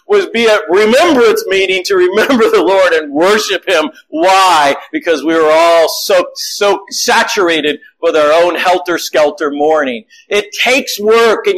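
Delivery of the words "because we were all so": ordinary talk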